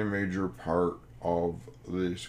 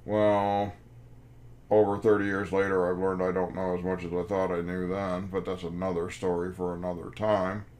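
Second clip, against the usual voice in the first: usual speaking voice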